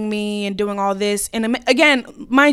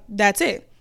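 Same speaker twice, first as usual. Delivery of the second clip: ordinary talk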